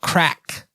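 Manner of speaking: "craic" spoken like the English word crack; Irish word craic. Shown as a demonstration. Teaching the co-host an Irish term, saying "craic" as crack. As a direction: say "craic" as crack, rhyming with back. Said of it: "pronounced crack".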